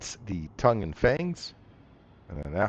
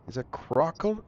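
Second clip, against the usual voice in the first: ordinary talk, nothing marked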